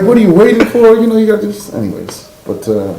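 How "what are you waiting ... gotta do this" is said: high, urging auntie impression; mimicked elder pressing the point; pushy relatives. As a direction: nag him to get married, pitched way up. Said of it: "They're like".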